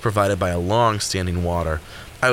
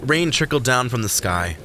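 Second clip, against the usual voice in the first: ordinary delivery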